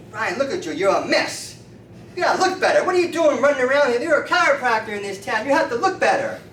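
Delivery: loud, high-pitched telling-off; acted scolding. Drunk street man berates his chiropractor, loud scolding. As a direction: scold him at rising volume, as if fed up.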